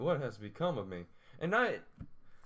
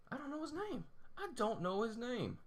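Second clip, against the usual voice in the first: squeaky whine; 12 semitones higher, 4 dB quieter than usual. Pretend. Squeaky frustrated complaint.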